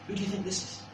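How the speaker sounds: usual speaking voice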